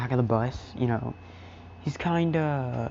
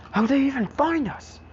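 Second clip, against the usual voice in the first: much higher, startled question; alarmed surprise; 13 semitones higher, 4 dB louder than usual. Acted. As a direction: snap round in alarm. Aizawa alarmed at villains.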